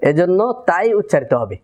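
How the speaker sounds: usual speaking voice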